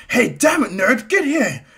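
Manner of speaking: loud, high acted shout; Bakugo's angry yell. Hothead calls back the friend who teased him, angry yell. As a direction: holler after him in hammed-up outrage.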